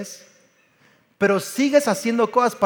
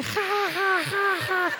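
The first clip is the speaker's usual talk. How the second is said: put-on high, light little-girl giggle, softer; imitated giggle; 10 semitones higher, 7 dB quieter, much slower than usual. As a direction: mimic the daughter's giggle. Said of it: "Sofía riéndose". (Sofía se ríe, risita aguda imitada)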